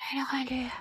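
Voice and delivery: almost silent murmur; barely audible. Acted. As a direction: say it so softly it barely carries. Repeats, still barely audible.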